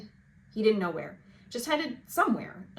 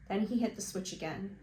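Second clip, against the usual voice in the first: plain reading voice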